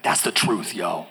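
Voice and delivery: low, cool-guy drawn-down delivery; friend's catchphrase. Retold or imitated. Preacher imitating an old friend's approval, low and cool. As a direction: drop low and let the last word sink. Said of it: "he's like".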